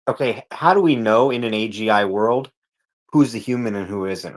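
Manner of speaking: normal talking voice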